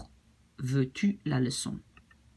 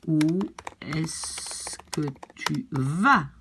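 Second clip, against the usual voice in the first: very slow, word by word; slow teaching model; much slower than usual. Shown as a demonstration. Teacher models "where do you go" in French for learners, very slowly. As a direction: draw out each word for learners to follow. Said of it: "Try to do it with me".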